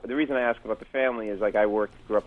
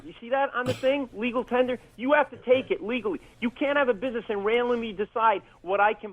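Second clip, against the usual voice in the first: raised, higher pitched indignant protest; retold indignant argument; 7 semitones higher than usual. Comic quoting himself demanding his onion, indignant and higher. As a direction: insist on your rights, voice pitched up. Arguing with cashier over change.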